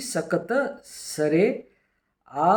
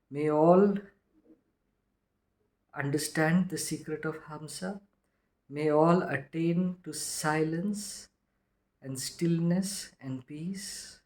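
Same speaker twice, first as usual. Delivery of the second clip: quiet blessing; 5 dB quieter than usual. Acted. Speaker blessing listeners at the end, soft prayerful benediction. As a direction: bless the listeners gently as you finish.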